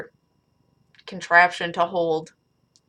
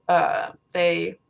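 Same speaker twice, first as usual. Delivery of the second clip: usual speaking voice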